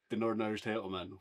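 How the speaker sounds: ordinary talk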